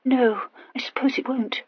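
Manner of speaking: soft whispered resignation; a forlorn, breathy whisper. A narrator's reading. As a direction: let it fall in a hopeless whisper. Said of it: "agreed Horatia forlornly".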